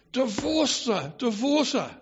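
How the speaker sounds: high, emphatic, hammed-up demand; mock insistent urging. Adviser urges king to drop the queen, mock insistent.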